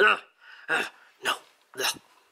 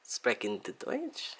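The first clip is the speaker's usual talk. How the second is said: slow, softer mock-German accent; hammy German accent, dropping softer; bad German accent; 3 semitones lower, 9 dB quieter, slower than usual. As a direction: toss out German in a put-on accent. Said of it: "even though it's shitty accent".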